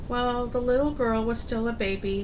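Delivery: plain reading voice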